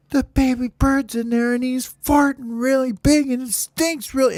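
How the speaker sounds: very high, excited falsetto; squeaky tattling. Acted. Birds report the farting to the counselor, squeaky tattling. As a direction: blurt the complaint in a tiny squeal.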